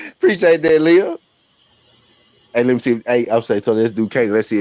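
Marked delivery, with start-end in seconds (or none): 0.1-1.2 high, louder grateful shout; excited thanks. Real, not acted. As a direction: cheer the player for scoring. Thanking player who scored.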